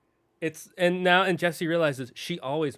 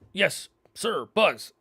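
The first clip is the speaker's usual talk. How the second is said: higher, louder salute in an altered voice; obedient toy soldier; 6 semitones higher, 4 dB louder, much slower than usual. Retold or imitated. Buzz toys obeying their second in command, soldierly. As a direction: snap to attention for a superior.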